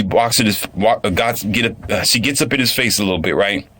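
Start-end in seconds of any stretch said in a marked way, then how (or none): none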